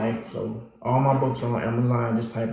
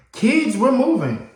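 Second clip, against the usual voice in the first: higher, slow, louder announcing; mom's announcement; 12 semitones higher, 4 dB louder than usual. Retold or imitated. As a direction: break the news to the kids. Mom announces move.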